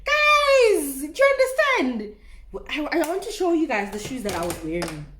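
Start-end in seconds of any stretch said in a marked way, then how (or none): 0.0-2.1 high, loud plea to the viewers; exasperated cry. Real, not acted. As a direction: cry out in disbelief.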